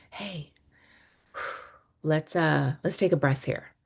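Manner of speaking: soft "hey", then a sigh and an easy suggestion; calm, hushed. Acted. Stepping in to interrupt the household chaos, calm and hushed. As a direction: gently break the frenzy, starting almost in a whisper.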